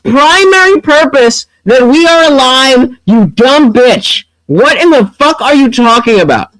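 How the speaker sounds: high-pitched outraged yelling; shouting in disbelief at her argument; incredulous angry rant. Real, not acted. Streamer yelling back at the woman in the debate clip, outraged.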